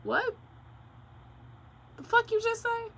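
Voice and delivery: high-pitched stunned disbelief; incredulous. Retold or imitated. Reacting to roommate's sock accusation, incredulous. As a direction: squeak it out, unable to believe it.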